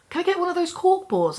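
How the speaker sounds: put-on pleading like a kid for a toy; eager request. Eagerly asks for a cork board.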